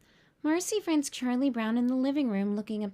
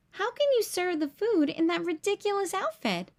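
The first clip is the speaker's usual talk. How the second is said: a mild scold, pitched higher; 8 semitones higher than usual. A narrator's reading. Boy scolding his dog over a silly outfit, mild scold. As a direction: tell off the dog, lightly voiced. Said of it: "he scolds Snoopy".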